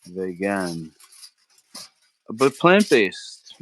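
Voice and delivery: ordinary delivery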